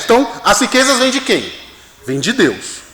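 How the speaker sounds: ordinary talk, nothing marked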